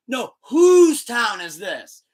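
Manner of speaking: slow, high and loud, correcting the crowd; insistent shout. Retold or imitated. Punk correcting the drunk fan, slow loud call. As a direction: lean hard on whose, drawn out. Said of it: "And Punk's like".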